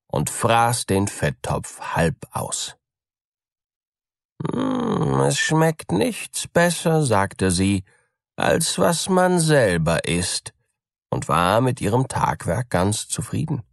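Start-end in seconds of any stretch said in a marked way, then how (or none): 4.5-7.1 high, smug purring cat voice; the cat smacks its lips over the fat, pleased; cat savouring. A narrator's reading. Cat alone with the stolen fat, smug and high. 8.4-10.5 high, smug purring cat voice; the cat smacks its lips over the fat, pleased; cat savouring. A narrator's reading. Cat alone with the stolen fat, smug and high.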